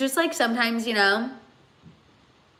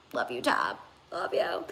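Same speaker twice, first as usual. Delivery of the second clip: lower, softly whispered thanks; whispered love you; 9 semitones lower, 9 dB quieter than usual. Real, not acted. Thanking viewer Tab for comfort, tender whisper. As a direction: breathe it out tenderly to a friend.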